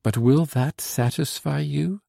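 imploring, with a lifted pitch; beseeching. A narrator's reading. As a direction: ask it as a gentle plea. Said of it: "looked at me beseechingly and said".

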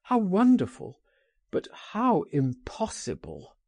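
high-pitched cry of wonder, then dropping; exclaiming cry. A narrator's reading. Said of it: "he cried".

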